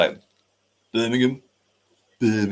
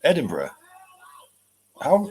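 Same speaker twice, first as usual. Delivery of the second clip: ordinary delivery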